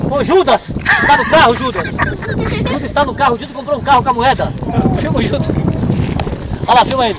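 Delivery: loud excited calling out; shouting. Real, not acted. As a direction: yell it across the yard. Calling out about Judas's car.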